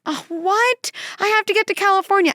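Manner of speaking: high, loud outburst of dismay; upset passenger. Retold or imitated. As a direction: protest like a stranded passenger. Passengers hear emergency landing news.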